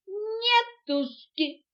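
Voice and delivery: high, loud, drawn-out refusal; defiant interruption. A narrator's reading. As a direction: cut in with a stretched no way. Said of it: "перебила слонёнка мартышка". (капризно перебивая)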